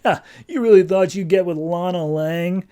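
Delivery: hammed-up bully jeer; retold bully taunt. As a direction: taunt a rival as a bully would. Bully taunts Clark over Lana.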